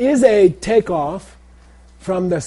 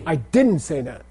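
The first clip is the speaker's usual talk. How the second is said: DIDN'T punched above the other words; stress on DIDN'T. Shown as a demonstration. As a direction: lean hard on DIDN'T. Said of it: "how we use emphasis in English".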